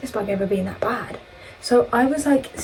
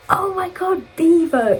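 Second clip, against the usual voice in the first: high, louder squeal of recognition; excited exclamation; 10 semitones higher, 4 dB louder, slower than usual. Retold or imitated. Friend spots Bevo walking out, excited exclamation. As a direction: imitate a friend's loud, high-pitched exclamation.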